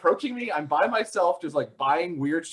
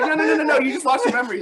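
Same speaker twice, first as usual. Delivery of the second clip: laughing mock panic; 9 semitones higher, 3 dB louder, faster than usual.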